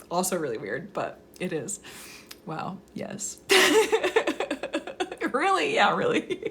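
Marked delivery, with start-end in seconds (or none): 3.4-5.3 loud, high laugh; real burst of high giggles. Real, not acted. Streamer catching up with a friend, laughing.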